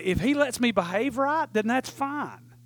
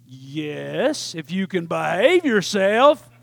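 low, grudging yes, drawn out; slow, conditional mock consent; wary teen reply; 6 semitones lower, much slower than usual. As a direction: agree reluctantly, imitating a wary teenager. Wary teen answers dad's invitation.

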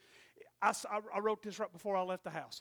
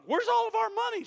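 high, loud startled cry; husband blurting out his shock; shocked question; 12 dB louder than usual. Retold or imitated. Husband to wife, shocked and loud.

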